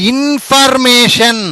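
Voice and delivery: Information drawn out, each syllable clear; slow dictation. Shown as a demonstration. Teacher introducing the next word to students slowly. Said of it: "ఇంకొక పదం చూద్దాం".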